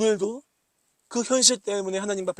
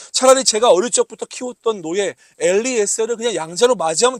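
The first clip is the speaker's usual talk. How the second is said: exasperated complaint; 4 semitones higher, 6 dB louder than usual. Acted. Pastor voices Abraham's complaint.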